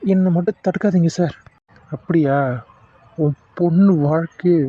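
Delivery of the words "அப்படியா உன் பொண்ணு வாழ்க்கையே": low, cold warning to a meddler; menacing villain. Acted. Villain warns the doctor to mind his own business, low and menacing. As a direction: lean in low and threaten him.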